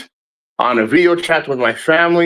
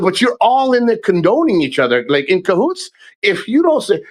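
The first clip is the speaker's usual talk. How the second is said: heated retort; 7 semitones higher than usual. Retold or imitated. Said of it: "I said".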